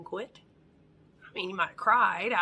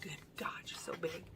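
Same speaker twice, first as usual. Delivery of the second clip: fast, hushed aside to yourself; soft, quick self-critical mutter; muttered thought; 4 semitones lower, 16 dB quieter, faster than usual. Retold or imitated. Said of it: "which I thought".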